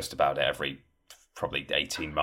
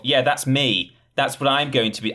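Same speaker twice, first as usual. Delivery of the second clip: higher, excited boast; mock heroic; 7 semitones higher, 4 dB louder than usual. Fan imagining being the Spartan, mock heroic boast. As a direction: play the eager fan picturing himself.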